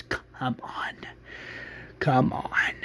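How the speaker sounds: low, menacing whisper. Acted. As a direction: whisper it twice with a pause. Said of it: "that's where he whispers".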